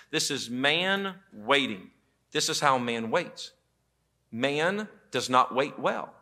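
usual speaking voice

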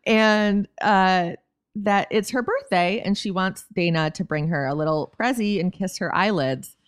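ordinary talk

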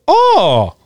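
high cartoon "oh"; Mario whoop. Host keeps mimicking the game character, high whoop.

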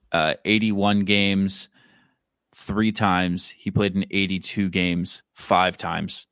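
ordinary delivery

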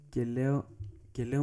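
usual speaking voice

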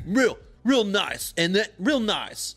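slow, dopey dude drawl; mock dude voice. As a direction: stretch it out like an awkward dude. Mocking naked contestant's answer.

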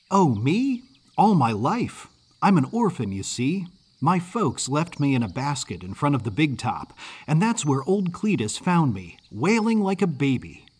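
put-on cheerful Yankee kid telling his life story; lively young Northern lad; comfortable, chatty boy. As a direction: chat easily like a friendly Yankee boy. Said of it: "He spoke so comfortably".

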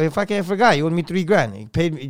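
ordinary talk

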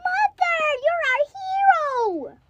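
high, loud happy shout; joyful kid. Acted. Rescued kid calling out to mother, high joyful cry. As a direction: cheer for mom. Kid freed from wolf's belly.

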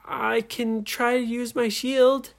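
high-pitched character voice; young story heroine. A narrator's reading. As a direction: offer the plan in a high voice.

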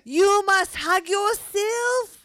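put-on slow, high, loud French-accented cheer; gushing French coach praising her students; French teacher's accent. Teacher cheering her swim students in a French accent. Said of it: "my French accent's getting better 'cause she'll be like".

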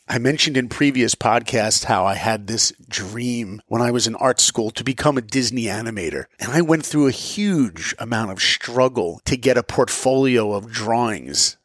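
usual speaking voice

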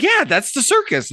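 very high, emphatic agreement; exasperated confirmation. Acted. Bartender to the endlessly questioning dog, exasperated. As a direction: confirm the obvious, pitched right up.

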